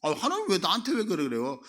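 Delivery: loud, indignant Cain brushing off God; brazen denial. Retold or imitated. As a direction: Cain talking back to God, shamelessly indignant. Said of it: "이런 뉘앙스거든요".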